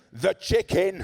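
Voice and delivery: put-on high, loud, triumphant little girl; child's punchline. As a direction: shout it like a proud kid. Daughter's nonsense punchline.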